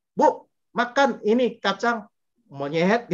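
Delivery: "Bu, makan ini kacang" high and slow, pause, then "monyet" dropped alone; wrong intonation. Shown as a demonstration. Offering peanuts to a neighbour, "monyet" split off after a pause. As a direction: set "monyet" apart after a pause, like a name-calling. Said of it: "tapi intonasinya salah itu bisa bahaya loh".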